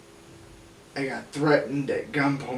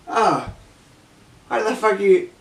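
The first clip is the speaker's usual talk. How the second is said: put-on a botched Australian accent, higher and louder; failed Australian accent; 7 semitones higher, 8 dB louder than usual. Drunk Australian confronting him on the hill, botched Australian accent. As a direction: start an Australian voice that slips off. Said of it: "wait, that's not the Australian accent".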